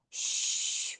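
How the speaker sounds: 쉬 as a long unvoiced hush; voiceless sh sound. Shown as a demonstration. Teacher showing a phonetic symbol, long airy 쉬. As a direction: draw 쉬 out in pure air. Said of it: "이 발음은 무슨 발음 표시냐면".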